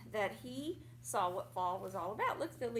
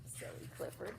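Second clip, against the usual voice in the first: giggled through the words; soft breathy giggle, much quieter; laughing; 5 semitones higher, 10 dB quieter than usual. Real, not acted. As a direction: laugh fondly at the silly puppy. Laughing at the dog's antics.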